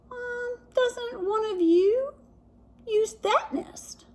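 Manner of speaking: high, slow, hushed feigned innocence; sly hint. Acted. Jack to the birds, slow sly hushed hint. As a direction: plant the idea slyly.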